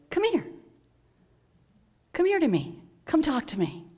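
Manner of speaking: put-on high little old man inviting the drunk; whimsically high voice. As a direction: coax the drunk over in a high frail voice. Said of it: "kind of almost whimsically high voice".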